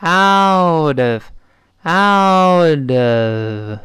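"out of" hugely elongated, vowels held; very slow. Shown as a demonstration. Teacher showing that anyone can say it, very slow. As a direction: stretch "out of" syllable by syllable. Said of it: "我说慢".

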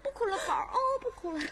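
put-on soft coaxing as if to a baby; soothing. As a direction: hush a crying child. 哄哭着的二姑. (轻声哄孩子)

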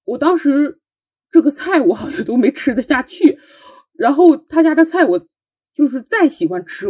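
usual speaking voice

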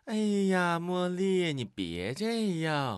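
high, drawn-out, prissy parody of a gentle scolding; mock effeminate dad. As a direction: coo it high and slow like a limp-wristed dad. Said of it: "这好像是一个娘炮吧？".

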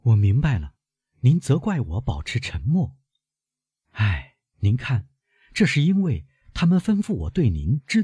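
put-on earnest young woman, pitch lifted; girl appealing to her grandfather; apologetic granddaughter.

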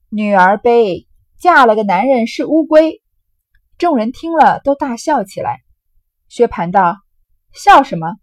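loud, lively storytelling voice acting out a drinking game; dramatic novel reading. Teacher reads the drinking-game scene aloud, loud and lively. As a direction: read the scene with relish, loud and animated.

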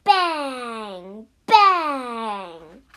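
drawn-out bangs, hard attack; gunshot imitation. A narrator's reading. Farmer fires his gun from the window, booming bangs. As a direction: fire each bang and let it ring out. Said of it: "He fired his gun".

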